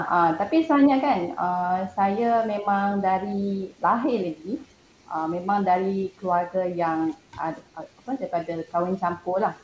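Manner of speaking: usual speaking voice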